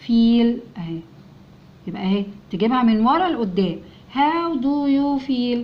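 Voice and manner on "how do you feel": very slow, stretched-out reading; slow model reading. Shown as a demonstration. Teacher reading the joined-up question to children, very slowly. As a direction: draw every word out for beginners.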